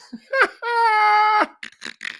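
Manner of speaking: a high, loud cackling laugh; shrieking laugh. Real, not acted. Laughing at the warrant.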